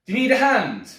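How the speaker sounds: loud, high-pitched offer; helpful stranger. Acted. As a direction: call it out to someone behind a car window. Stranger at car window offers help.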